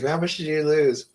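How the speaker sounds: high, eager mock question; excited asking. Friends at weigh-in asking excitedly about the loss. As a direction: ask it like you can't wait to hear. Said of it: "every week we'd be like".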